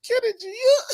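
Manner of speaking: high squealing laugh through words; helpless laughter. Real, not acted. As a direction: crack up while calling him out. Host cracks up at caller's accent.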